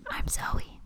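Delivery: hushed, voiceless whisper; whispered sign-off. Pretend. Co-host signs off the podcast, whispering. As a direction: whisper your name into the mic. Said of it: "That whispered voice was Zoe saying".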